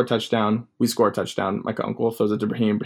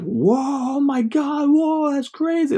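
high amazed shout; amazed crowd; much slower than usual. Retold or imitated. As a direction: whoop like the other team. Other team amazed.

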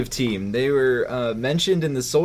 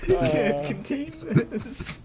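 much higher, very slow giggle; laughing; 14 semitones higher, much slower than usual. Real, not acted. Co-host unable to stop laughing. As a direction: squeeze words out through laughter.

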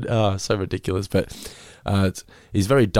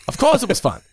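Jimbo voice; 13 semitones higher than usual. Retold or imitated. Imitating Jimbo answering kids.

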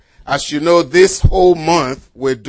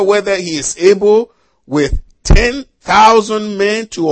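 emphatic, raised preacher's reading voice; scripture reading; 3 semitones higher, 5 dB louder than usual. Acted. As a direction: read the Bible passage out firmly to a congregation. Reads scripture aloud to congregation.

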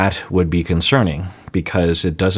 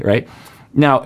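ordinary delivery

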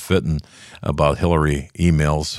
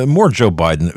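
ordinary talk

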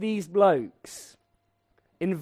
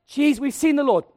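much higher and louder, insistent; excited pleading; 10 semitones higher, 9 dB louder than usual. Retold or imitated. Disciples insisting to Thomas, high and loud. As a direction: urge him, almost shouting. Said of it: "disciples pleading with Thomas".

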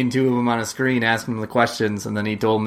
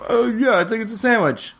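higher-pitched mocking quote; lightly voiced clueless guest, pitched up; mock dopey agreement; 11 semitones higher than usual. Co-host mimicking game show contestants' answer, mock agreement. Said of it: "Jimmy Fallon were like".

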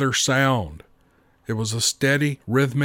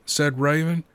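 usual speaking voice

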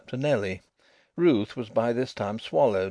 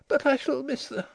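put-on much higher girlish voice, mildly protesting; dazed protest; 14 semitones higher than usual. A narrator's reading. Dazed girl protesting to Denry at the cab, high-voiced. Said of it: "the dazed girl protested".

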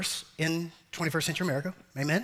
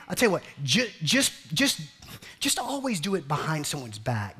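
ordinary talk, nothing marked

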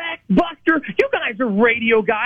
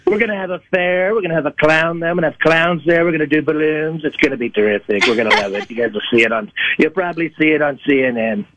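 lower, boastful Trump voice; Donald Trump impression; 3 semitones lower than usual. A caller playing Donald Trump for the hosts, boastful and lower. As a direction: brag like Trump on a rally stage. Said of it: "It's Donald Trump".

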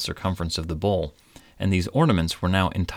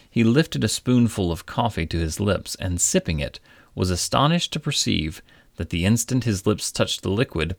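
ordinary delivery, nothing marked

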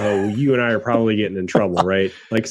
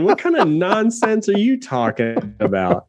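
mock outrage; 7 semitones higher, slower than usual. Teasing friend over bad pour.

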